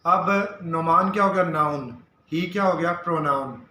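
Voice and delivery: ordinary delivery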